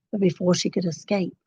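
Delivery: ordinary delivery, nothing marked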